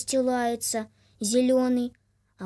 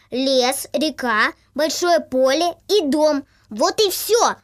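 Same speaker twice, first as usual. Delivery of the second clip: firm goose leader; 2 semitones higher, 7 dB louder than usual. Acted. Leader explains firmly.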